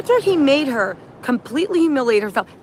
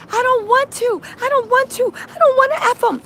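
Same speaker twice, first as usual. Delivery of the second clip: high-pitched imitation of screamed protest; retold screaming; 12 semitones higher than usual. Host acts out Jane screaming her refusal, high mock scream. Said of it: "Jane started screaming".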